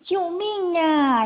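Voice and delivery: a hint of a scared little mole's yell; drawn-out storybook scream; mock scream for help. A narrator's reading. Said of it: "鼹鼠尖叫着".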